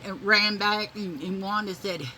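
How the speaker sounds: normal talking voice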